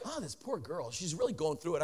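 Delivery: soft, sympathetic thought under the breath; quiet inner thought. Retold or imitated. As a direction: murmur it to yourself with pity. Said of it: "And I'm like".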